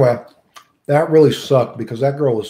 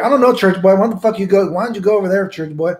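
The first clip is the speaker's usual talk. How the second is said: higher, louder irritated brush-off; mock-irritated younger self; annoyed retort; 7 semitones higher, 4 dB louder than usual. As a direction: snap back at an annoying kid. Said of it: "I'm like".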